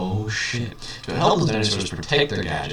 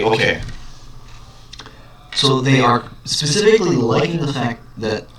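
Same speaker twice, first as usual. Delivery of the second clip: ordinary delivery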